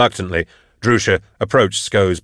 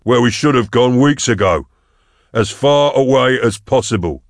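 raised, emphatic parting retort; angry retort; 5 semitones higher, 4 dB louder than usual. A narrator's reading. Hunter walking out on the group, angry retort. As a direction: throw it back while leaving, pitch up. Hunter storming off, answering back.

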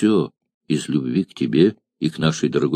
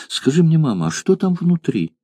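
put-on curious little girl; 8 semitones higher than usual. Child asks about urn.

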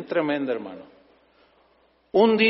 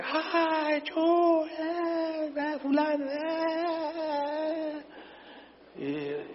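imitated crowd calling; 10 semitones higher, 8 dB quieter, much slower than usual. Crowd shouting names, imitated.

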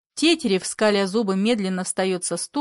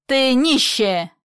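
raised, slow angry cry; angry insult; 5 semitones higher, 5 dB louder, slower than usual. Acted. Old man insults someone in a family quarrel, angry. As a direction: hurl it slowly, louder and higher.

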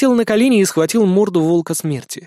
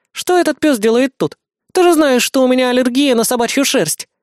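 put-on high, petulant young girl; bratty devil girl; 8 semitones higher, 3 dB louder than usual. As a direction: whine like a spoiled little devil. Lana, young devil girl, complaining.

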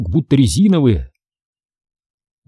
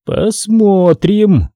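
very slow, drawn-out reply; lightly voiced deliberation; muttering chief; 8 semitones higher, 3 dB louder, much slower than usual. A narrator's reading. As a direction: stretch it out as a chief reserving judgment. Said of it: "наконец пробормотал он".

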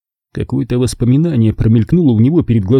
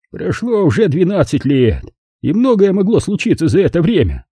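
higher-pitched count with a hint of gruffness; lightly gruff reply, barely accented; gruff, faint accent; 8 semitones higher than usual. A narrator's reading. Ex-husband answers Zelda's jibe, lightly gruff with faint accent. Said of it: "грубым голосом с едва заметным акцентом".

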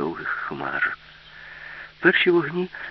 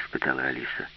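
plain reading voice

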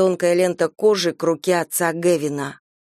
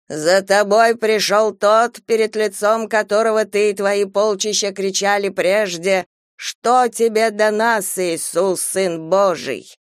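slow, weighty exorcist proclamation; solemn preaching; slower than usual. Acted. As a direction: intone it like scripture against evil. Priest proclaims scripture at demon.

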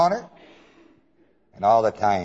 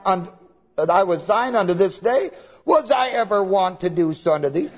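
higher, pleading donkey voice; lightly voiced donkey; 9 semitones higher than usual. The donkey reminding Balaam of her loyalty, higher. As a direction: ask it like a hurt old donkey.

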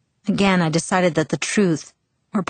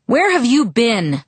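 louder, slow demanding question; thundering; 6 semitones higher, 4 dB louder, slower than usual. Acted. A father confronting his late daughter, thundering. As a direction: boom it at her. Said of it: "he thundered".

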